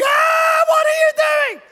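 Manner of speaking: very high, loud shriek of panic; alarmed mother's shriek. Acted. Mother watching dad push the swing too high, hammed-up shriek. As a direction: shriek like a horrified mum. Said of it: "She's going".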